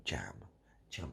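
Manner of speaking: ordinary delivery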